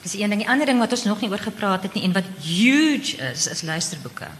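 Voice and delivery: ordinary delivery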